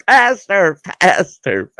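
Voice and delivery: high, loud, gleeful shout; excited yelling. Retold or imitated. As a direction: yell it like a kid on a wild ride. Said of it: "in there yelling".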